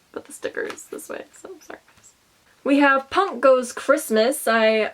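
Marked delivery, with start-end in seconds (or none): 0.1-1.8 whispered mutter. Real, not acted. Muttering while fixing case.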